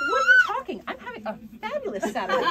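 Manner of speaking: very high, loud, fast gleeful squeal; dog voiced as thrilled and oblivious; cheerful dog voice. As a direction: squeal with carefree delight. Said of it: "this dog is like".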